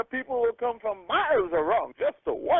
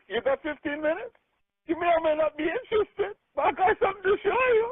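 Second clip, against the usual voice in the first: higher, punchy, eager teaser line; excited pitch; 7 semitones higher than usual. Shown as a demonstration. Pitching a friend on the phone, eager and fired up. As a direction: hype the offer up like you can't wait. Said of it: "just those few words I just said".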